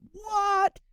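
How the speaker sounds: sudden high shocked yelp; a squeaky, amazed what; stunned what. Shown as a demonstration. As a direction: jump way up in pitch in disbelief. Demo text, shocked about the equity.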